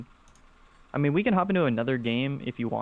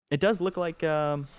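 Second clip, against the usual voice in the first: ordinary delivery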